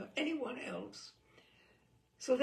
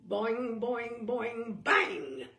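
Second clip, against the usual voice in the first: mock clock chimes; 6 dB louder, much slower than usual. Shown as a demonstration. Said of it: "Grandfather clocks".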